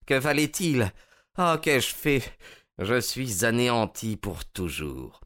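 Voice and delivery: high wail sinking into defeat; anguished, beaten captive; despairing. A narrator's reading. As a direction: lament your ruin, pitch falling away. Ruined spy realises his blunder.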